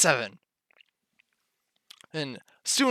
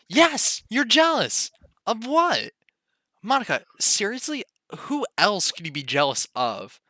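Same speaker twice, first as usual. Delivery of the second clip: angry; 6 semitones higher than usual. Acted. Acted quarrel between friends.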